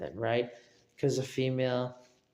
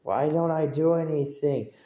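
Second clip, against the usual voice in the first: higher, louder whiny complaining; mock frustrated rant; 5 semitones higher, 7 dB louder than usual. Imitating a grown man who can't scare him, loud whiny rant. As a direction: rant like a baffled bully. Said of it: "and they're like".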